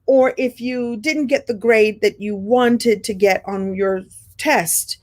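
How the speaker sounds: ordinary talk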